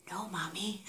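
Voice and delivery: tiny whispered refusal; imitated child's whisper. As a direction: whisper back like a toddler refusing. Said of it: "turned around to me and whispered".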